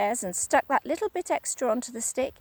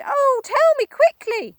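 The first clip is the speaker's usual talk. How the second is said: much higher, louder begging cry; Missus Twit crying; 9 dB louder than usual. Acted. Wife begging for the cure, high cry. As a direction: beg urgently. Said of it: "she cried".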